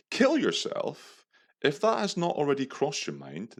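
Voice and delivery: high, swooping theatrical declamation; mock-indignant tirade. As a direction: declaim each insult like a grand proclamation. Reads out his insulting reply.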